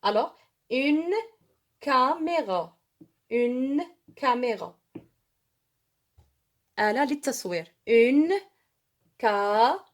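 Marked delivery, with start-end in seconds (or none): 0.0-4.8 "une" slow and leaned on before "caméra"; stressed article. Shown as a demonstration. Teacher stresses the article for the class. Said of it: "Une ou un". 7.9-9.8 "Une caméra" louder with "Une" punched; loud emphatic demo. Shown as a demonstration. Teacher calls the word out to the class, loud. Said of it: "Une ou un".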